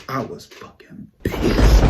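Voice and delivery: slow, hissed whisper of anger; whispered fury. Real, not acted. Telling fans how mad she was, slow whisper. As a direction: spit it out under your breath. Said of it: "I was pissed".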